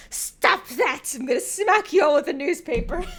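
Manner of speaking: loud, high laughing yell; mock scolding. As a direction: scold like a naughty dog, cracking up. Mock scolding over dog puns.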